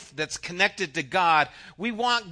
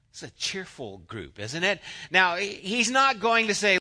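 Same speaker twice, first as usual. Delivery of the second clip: usual speaking voice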